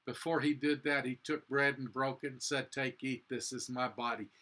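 ordinary talk

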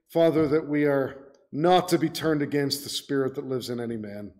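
ordinary talk

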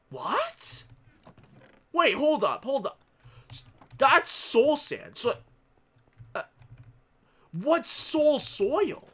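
high surprised question, slowed; puzzled surprise. Real, not acted. Gamer puzzled by an unfamiliar block, slow surprise. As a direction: wonder aloud.

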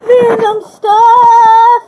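high, loud, slow show title; announcer shout. Acted. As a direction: boom it like a show host.

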